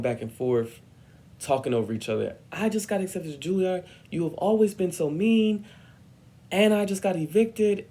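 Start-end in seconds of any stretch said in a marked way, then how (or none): 2.5-7.9 high, raised-voice outburst; heated retort. Retold or imitated. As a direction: argue back over someone, high and loud. Shouting over the director, retold.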